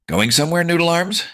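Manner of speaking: high, loud taunting shout. Acted. Bully shouting across the hall at the narrator. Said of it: "I heard the voice shout".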